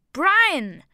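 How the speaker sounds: high, loud startled cry of a name; both boys caught off guard; sudden exclaimed name; surprised shout. A narrator's reading. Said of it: "riefen ich und Seyden".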